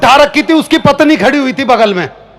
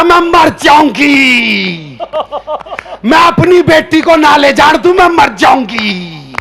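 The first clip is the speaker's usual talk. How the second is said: slow, hammed-up wailing aunt; mock wailing; slower than usual. Aunt clinging to his feet, wailing in mock grief. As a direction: stretch each word in a fake lament.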